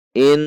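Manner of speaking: "In" with a long humming n; nasal gunnah. Shown as a demonstration. Teacher demonstrating gunnah, "In" with droning held n. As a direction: hold the n of "In" through the nose. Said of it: "Harus dengung".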